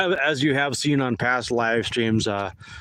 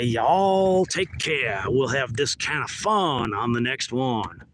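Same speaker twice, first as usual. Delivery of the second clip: drawn-out Texas drawl, stretched "y'all"; big, slow Texas sign-off; Texas accent; slower than usual. Shown as a demonstration. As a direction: lay on a slow Texan twang. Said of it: "So I can do a Texas accent".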